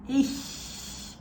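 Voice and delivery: Ish with the ch hissed unvoiced through spread lips; German ish. Shown as a demonstration. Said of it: "So try with me".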